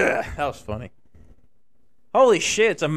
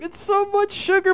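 lightly voiced squeaky complaint, much higher; high-pitched mock complaint; 3 dB louder than usual. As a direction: imitate Bert whining in a high voice. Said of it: "but he was like".

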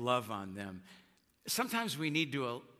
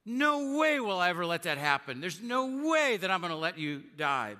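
protesting; 5 semitones higher, 5 dB louder than usual. Acted. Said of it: "Peter responds".

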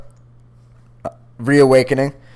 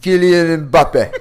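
put-on slow, laughing French accent on the name; 3 semitones higher, much slower than usual. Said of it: "pronounce that name with a French accent".

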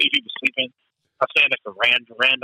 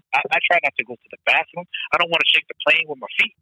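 ordinary delivery

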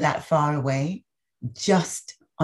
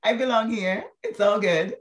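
relieved delight; 8 semitones higher than usual. Retold or imitated. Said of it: "and be like".

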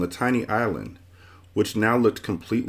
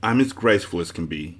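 plain reading voice

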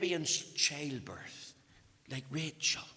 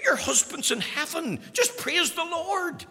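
mock cheery minister; 11 semitones higher, 5 dB louder than usual. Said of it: "and said".

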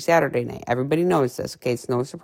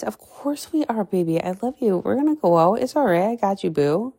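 reassuring higher voice; 6 semitones higher than usual. Retold or imitated. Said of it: "her answer to husband".

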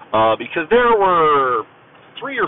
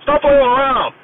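high-pitched imitation of an angry yell; screamed and hollered; 13 semitones higher, 4 dB louder than usual. Rescue swimmer berating a flailing recruit in the pool, imitated high holler. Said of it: "screamed and hollered".

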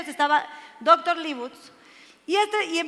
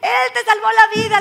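raised, high acted shout; 6 semitones higher, 8 dB louder, slower than usual. Husband telling his wife who saved her, shouting. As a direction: cry it out like an amazed witness. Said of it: "empieza a gritar".

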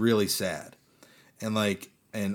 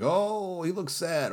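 sympathetic; 8 semitones higher, much slower than usual. Retold or imitated. Voicing own pitying first reaction.

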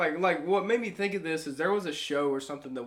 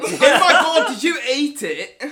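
mock British; 7 semitones higher, 10 dB louder than usual. British parody continues.

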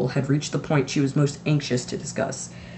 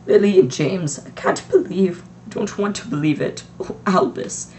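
shocked grief; 5 semitones higher, 4 dB louder than usual. A narrator's reading. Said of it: "Professor McGonagall gasped".

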